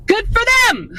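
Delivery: high delighted cheer; excited squeal. Real, not acted. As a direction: squeal it out happily. Cheering others' week off.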